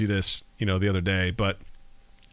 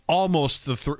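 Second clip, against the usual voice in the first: ordinary delivery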